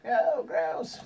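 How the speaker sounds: high voice of mock disgust; disgusted onlooker. Bystander reacting to the sailor's fish, high disgusted voice. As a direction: recoil in a high-pitched voice.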